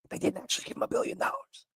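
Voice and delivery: whispered aside, almost breathless; hushed aside. Real, not acted. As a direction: drop to a whisper to concede the point. Aside correcting his own hyperbole.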